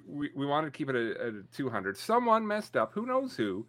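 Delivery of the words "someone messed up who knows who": high, coy dodge of blame; mock innocence. Real, not acted. Host to co-host about a list mistake, mock-innocent. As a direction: play innocent in a raised pitch.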